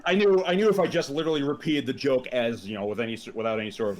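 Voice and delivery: ordinary talk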